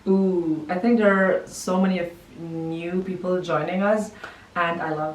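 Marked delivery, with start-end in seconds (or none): none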